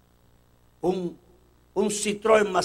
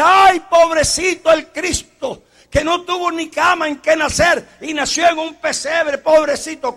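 mock pity; 8 semitones higher, 7 dB louder than usual. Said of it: "la gente que dice".

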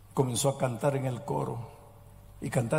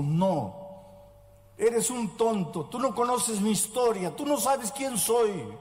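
higher, slowed, pushy retort; aggressive tone; 6 semitones higher than usual. Retold or imitated. The girl retorting to the young man who proposed, in a mild angry tone. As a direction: throw it back at him like an accusation. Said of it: "ella le dijo en tono agresivo".

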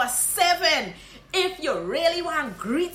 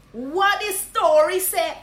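slow, loud Guyanese Creole question; Guyanese greeting; 5 semitones higher, 5 dB louder, slower than usual. Shown as a demonstration. Guyanese teacher repeating the hail. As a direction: stretch it out Guyanese-style. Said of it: "you just say".

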